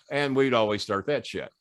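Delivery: normal talking voice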